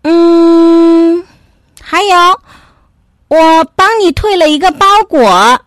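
high, loud voice after a long hum; little dog confessing. Acted. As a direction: think aloud, then blurt it out. Dog thinks, then confesses.

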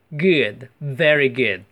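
slow model of very good with lip-bitten V; bitten V, rounded Good. Shown as a demonstration. Teacher slowly models very good for the class. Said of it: "那个V要咬下嘴唇".

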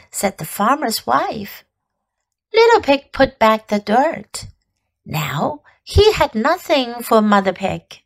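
normal talking voice

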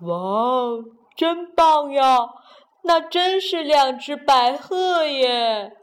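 amazed little frog. Acted. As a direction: marvel slowly at the birds.

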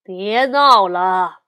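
loud, slow, drawn-out scolding; father's shout. A narrator's reading. Father bear looking up from newspaper, loud shout. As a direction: call out sternly, stretching the words. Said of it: "抬头喊道".

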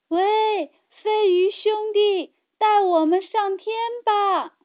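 high, loud call up to the sky; shouting hard. A narrator's reading. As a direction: yell with all your might to the flying fish. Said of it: "使劲儿地喊".

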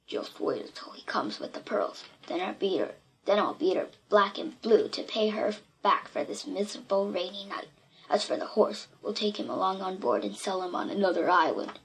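hushed, low-pitched conspiratorial aside. A narrator's reading. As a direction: confide the plan softly to an accomplice. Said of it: "And then in a low voice".

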